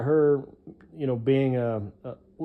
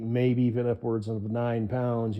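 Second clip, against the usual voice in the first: ordinary delivery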